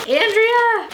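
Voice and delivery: high, loud laughing call of Andrea; excited squeal. Real, not acted. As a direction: squeal the name in delight. Finding more gifts.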